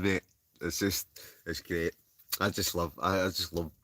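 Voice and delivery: ordinary talk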